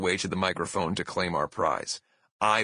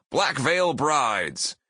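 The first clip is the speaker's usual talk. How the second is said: higher, drawn-out stage announcement; award announcer; 5 semitones higher, much slower than usual. Retold or imitated. Presenter announcing the winning band to the hall, drawn-out announcement. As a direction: announce the winners, stretching each word out. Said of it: "famous voice of Skid Row say".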